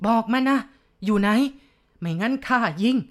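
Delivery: threatening shout. Acted. Chief threatening the unseen ghost with his gun, scared loud shout. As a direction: bark the threat out.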